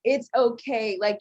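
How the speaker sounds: ordinary delivery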